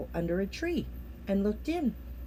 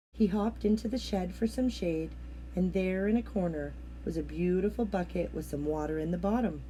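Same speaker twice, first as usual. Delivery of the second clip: ordinary delivery, nothing marked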